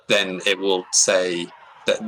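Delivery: usual speaking voice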